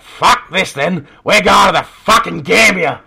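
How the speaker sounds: loud, high pub bloke bellow; imitating a bloke yelling at closing time; retold bellow. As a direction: belt it out like a drunk fed up at last drinks. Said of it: "just belts out".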